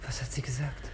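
a woman whispering after hearing of a friend's murder. Acted. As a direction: breathe the question in shock. Shocked woman whispers about murder.

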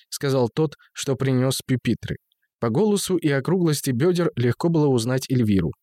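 ordinary delivery, nothing marked

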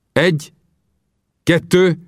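Vinetú counting out the duel, high and measured; solemn count. Acted.

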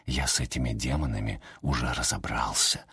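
hushed low whisper. A narrator's reading. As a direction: whisper it into her ear. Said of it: "прошептал в складке шифона".

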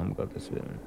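breathless whispered exasperation, dropped almost to nothing; whispered aside. Acted. Wolters cutting off Buschmann's lecture, whispered aside. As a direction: sigh it out under your breath, half to yourself.

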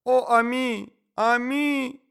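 high, loud cry of grief; high-pitched lamenting cry; lady's wail. A narrator's reading. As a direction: wail the pet's name up high. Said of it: "кричала дама".

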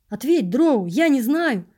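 loud, high, desperate plea; near-despairing cry. A narrator's reading. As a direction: cry out, begging for an answer. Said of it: "Почти в отчаянии вскрикнул я".

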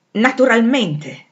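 high, loud, drawn-out cry; strident exclamation. A narrator's reading. Rochester scoffs at her seeking another post, strident exclamation. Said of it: "esclamò egli con voce stridula".

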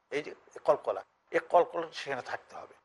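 ordinary delivery

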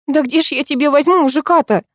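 high-pitched exasperated outcry; exclaiming. A narrator's reading. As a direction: exclaim it in indignant disbelief. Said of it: "воскликнула я".

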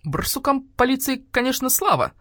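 grudging, high-pitched grumble; querulous concession; grumpy voice. A narrator's reading. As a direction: grumble it like a peevish old doctor bird. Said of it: "послышался вдруг ворчливый голос грача-врача".

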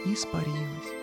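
usual speaking voice

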